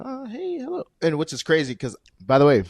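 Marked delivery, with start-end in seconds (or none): 0.0-0.8 high, soft little greeting; flower-dress customer. Retold or imitated. Supermarket worker imitating customers in flowered dresses, high soft put-on greeting. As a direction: pitch it way up and softly say hello. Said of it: "walk in with their flower dresses".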